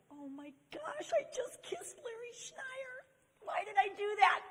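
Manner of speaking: drawn-out high squeal; mock panic. Woman behind the door after kissing her friend, panicked squeal.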